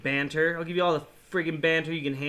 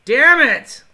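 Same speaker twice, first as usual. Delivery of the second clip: loud, high furious damn it; angry shout; 6 semitones higher, 12 dB louder than usual. Real, not acted. Gamer losing to opponent, shouting in real anger. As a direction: yell it at the screen.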